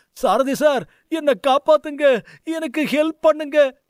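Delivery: put-on high, tearful child's plea; a weeping schoolboy begging his teacher; crying boy pleading. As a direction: beg for help through tears. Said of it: "அழுது கொண்டே கூறினான்".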